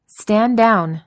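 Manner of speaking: loud sharp command; yelled. A narrator's reading. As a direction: bark the order out. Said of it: "Maria yelled".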